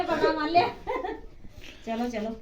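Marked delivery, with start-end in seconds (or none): none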